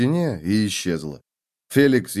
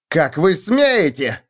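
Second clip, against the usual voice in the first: slow, louder indignant cry; a fake lord protesting as guards advance; outraged cry; 6 semitones higher, 4 dB louder, slower than usual. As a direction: cry out in mock offence. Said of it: "вскричал Феликс".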